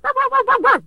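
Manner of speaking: high, loud yapping little dog; mock chihuahua yaps; yappy chihuahua bark. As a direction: yap like a tiny dog. Imitating chihuahua barking.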